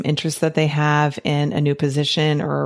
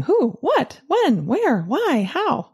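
lightly voiced eager child, much higher; curious little girl; 11 semitones higher, slower than usual. Reading a picture book's girl asking many questions, a hint of a child's voice. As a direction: rattle off the questions like a curious kid.

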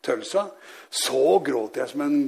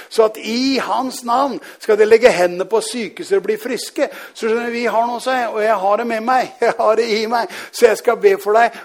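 raised, loud proclamation, driving each phrase home; fervent preaching; 6 semitones higher, 6 dB louder than usual. Real, not acted. Preacher tells sick Magne he will pray, fervent proclamation. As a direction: preach it out with full conviction.

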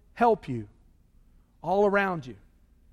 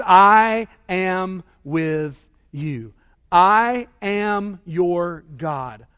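each I long and punched; very slow, word by word, leaning on I; stress on I; 5 dB louder, much slower than usual. Retold or imitated. Quotes God, repeating the emphasized I.

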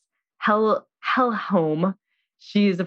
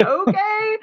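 much higher, a small whimpery okay; meek acceptance; 14 semitones higher than usual. Retold or imitated. Imitating the wife giving in to his strange explanation. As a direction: give in with a tiny pitiful squeak. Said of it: "She's like".

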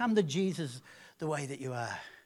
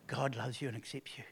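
ordinary talk, nothing marked

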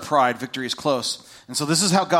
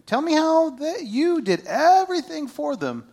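sarcastic challenge; 11 semitones higher than usual. Retold or imitated. God sarcastically challenging Elijah.

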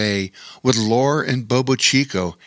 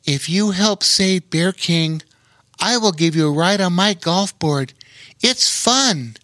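higher, kind and coaxing offer; kindly asking; 7 semitones higher than usual. Acted. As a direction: tempt him gently with a ride. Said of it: "Rowan kindly asked".

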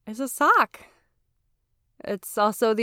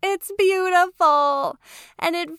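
high, drawn-out, overjoyed announcement; excited celebration; 8 semitones higher, 8 dB louder, slower than usual. Real, not acted. Podcaster announcing her finished sock to listeners, excited. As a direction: crow it out, high and loud, stretching words.

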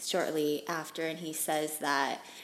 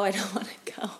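breathy whisper, half laughing through it; whispered crying plea; much faster than usual. Retold or imitated. Repeating it to the nurse, whispered crying plea. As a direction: whisper the plea as if tears choke it. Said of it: "I was crying".

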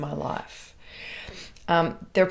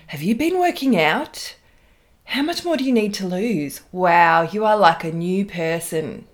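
put-on valley girl voice; 6 dB louder than usual. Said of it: "sounding like a valley girl".

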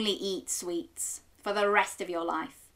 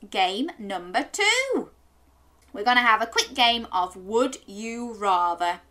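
usual speaking voice

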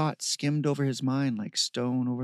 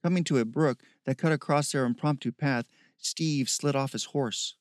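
usual speaking voice

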